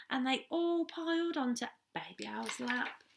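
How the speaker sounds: lively picture-book reading for little ones; story narration. Acted. Teacher reading a story to the reception class, animated storytelling. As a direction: read it out like storytime on the carpet.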